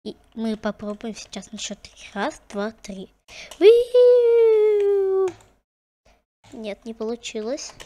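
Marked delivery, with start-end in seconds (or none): 3.6-5.3 high, loud whoop; excited whoop. Real, not acted. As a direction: whoop while falling. Falling in game.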